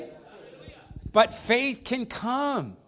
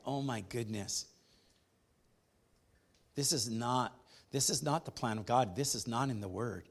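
softer, earnest pulpit voice; hushed preaching; 8 semitones lower, 8 dB quieter than usual. Acted. Pastor warns the church about doubt, hushed and earnest. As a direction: draw them in with a low, earnest hush. Quiet intimate stretch of the sermon.

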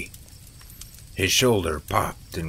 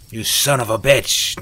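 louder, higher angry yell; raised-voice outburst; 4 semitones higher, 7 dB louder than usual. A narrator's reading. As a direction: hurl it as an insult. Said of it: "Neil yelled".

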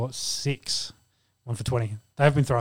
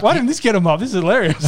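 amused; 6 semitones higher, 5 dB louder, faster than usual. Real, not acted. Cracking up at story.

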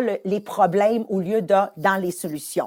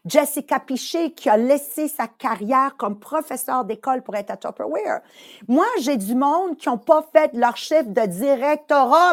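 ordinary talk

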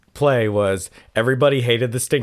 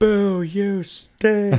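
high, long drawn-out mock boo; mock heckling; 9 semitones higher, much slower than usual. Audience booing the stink bug, mock heckling. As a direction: jeer slowly like a pretend heckler.

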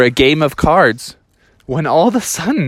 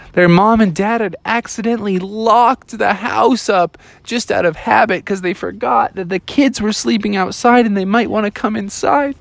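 plain reading voice

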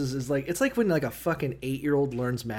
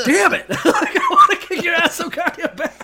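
high, loud, laugh-broken outburst; mock frustrated yell; 9 dB louder than usual. Acting frustrated would-be fighter, laughing.

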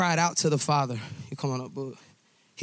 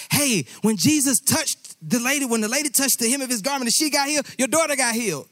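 higher, fast, eager relay of good news; hopeful excited news; 7 semitones higher, faster than usual. Retold or imitated. Messenger rushing to tell of a healing, eager and fast. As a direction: rush the happy report.

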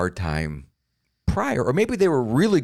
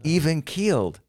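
drawn out and a touch higher; calm admirer voice; 5 semitones higher, slower than usual. Retold or imitated. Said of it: "everyone's like".

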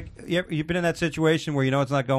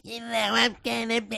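high, slow squeaky mock-woman voice; absurdly put-on falsetto woman; Andrew Dice Clay; 12 semitones higher, 3 dB quieter than usual. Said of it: "when Andrew Dice Clay does".

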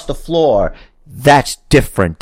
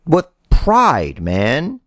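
usual speaking voice

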